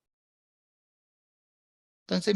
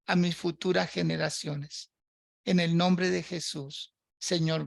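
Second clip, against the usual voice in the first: spoken prayer. Acted. Pastor leads a spoken prayer.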